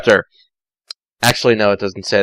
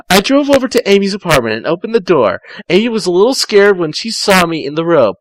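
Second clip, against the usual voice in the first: higher, fast story read; higher Tails narrating; 6 semitones higher than usual. Acted. Tails narrating the drive to Amy, pitched-up reading.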